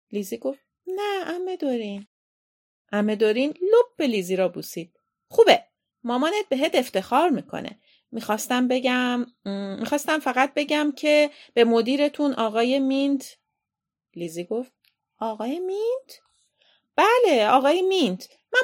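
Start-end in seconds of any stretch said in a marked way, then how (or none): 0.9-2.1 put-on little girl. Lizzie answering her aunt, little girl voice. 5.3-13.4 lightly voiced aunt, louder and higher; hesitate, then press on; chatty aunt. Aunt raising the headmaster with Lizzie, chatty aunt voice. 15.2-16.2 high voice, rising at the end; questioning little girl. Acted. Lizzie querying her aunt, questioning child voice.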